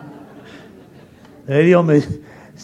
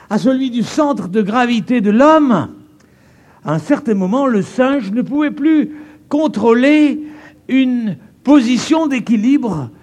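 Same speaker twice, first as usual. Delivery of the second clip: higher, louder, rising preaching mockery; preaching build; 10 semitones higher, 7 dB louder than usual. Preacher mocking evolution theory before the crowd, rising loud preaching.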